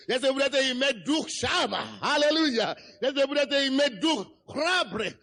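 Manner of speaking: ordinary delivery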